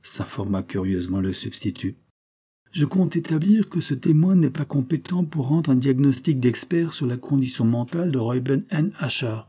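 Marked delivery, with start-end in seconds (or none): 2.7-9.4 raised, confident courtroom objection; bold retort. A narrator's reading. Lawyer objecting boldly to the court. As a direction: challenge the prosecutor boldly. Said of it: "répliqua audacieusement mister Exley".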